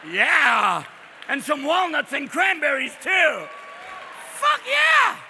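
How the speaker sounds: loud, high whooping cheer; hyped-up feaster. Acted. Settlers excited about the feast.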